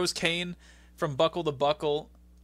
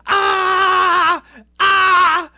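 much higher, much louder startled scream; Kane's freakout scream; 15 dB louder than usual. Retold or imitated. Retelling Kane freaking out, loud high scream. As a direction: shriek like a man losing it. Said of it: "And Kane goes".

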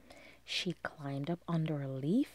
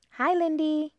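high, loud, squeaky "Hi, Lindy"; high, loud, bright squeak; squeaky greeting; 10 semitones higher, 10 dB louder than usual. Acted. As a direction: chirp it out like a tiny bug. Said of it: "squeaked her friend Molly".

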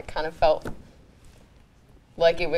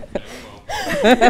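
high, loud delighted laugh; mischievous laugh; 5 dB louder than usual. Real, not acted. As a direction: burst out laughing at your own threat. Laughing at own on-air threat.

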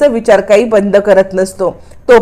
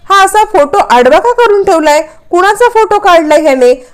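a higher, curious what-is-this; mild startled curiosity, pitched up; surprised wondering; 7 semitones higher than usual. Acted. Mother finds a hidden photo, mildly surprised and higher.

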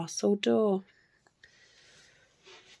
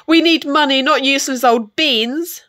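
louder, higher angry scolding; storybook mother's angry cry; furious mother; 5 semitones higher, 8 dB louder, faster than usual. A narrator's reading. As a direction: scold your son crossly. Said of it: "Jack's mother was furious".

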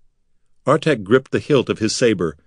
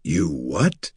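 lower, softer stunned question; 5 semitones lower, 4 dB quieter than usual. A narrator's reading. Artek stunned by the wizard's plan. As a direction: drop low in disbelief.

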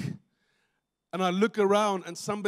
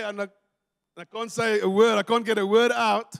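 ordinary delivery

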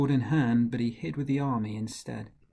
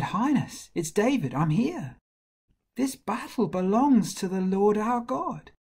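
high-pitched, urgent announcement; eager young shepherd; 9 semitones higher than usual. Acted. Shepherd offers himself to the king, high and eager.

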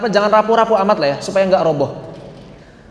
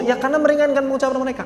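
normal talking voice

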